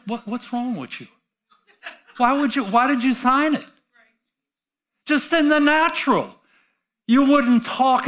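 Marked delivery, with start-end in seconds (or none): none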